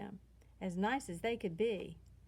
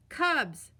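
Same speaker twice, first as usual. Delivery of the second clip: louder, sharp warning call of a mother; scolding mama; 4 semitones higher, 7 dB louder than usual. A narrator's reading. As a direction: cut the cubs off firmly. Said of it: "said Mama".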